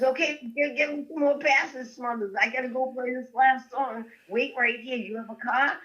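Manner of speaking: a lightly voiced rock star giving orders; a hint of a different man's voice; Frank's voice.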